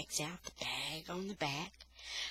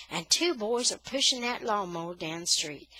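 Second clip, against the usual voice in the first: ordinary delivery, nothing marked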